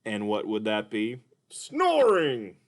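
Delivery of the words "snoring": high puppet voice falling on snoring. Acted. Puppet answers the kids' question in a high puppet voice. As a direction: say it as the high cartoon dolphin. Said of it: "Mister Dolphin".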